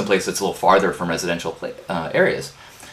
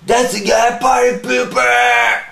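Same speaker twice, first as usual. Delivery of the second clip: much higher, loud, slow mocking shout; drunk heckler yell; 5 dB louder, slower than usual. Drunk bike tourists heckling the neighbor, slow mocking yell. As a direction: jeer it like a rowdy drunk. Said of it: "drunk people started yelling at him".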